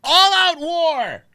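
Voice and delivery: high-pitched hammed-up shout, building; mock battle cry, slow and loud at the end; mock war cry. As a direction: declare a pretend war, climbing to a drawn-out yell. Mock declaration of war on friends.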